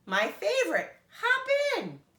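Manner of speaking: high, loud laughing glee; delighted grouch. A narrator's reading. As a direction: cackle with joy. Moishi delighted.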